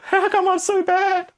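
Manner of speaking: high, loud cry then slow whine; hammed-up despair watching your video back; mock despair. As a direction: wail at your own video like a hurt kid. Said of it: "and you're like".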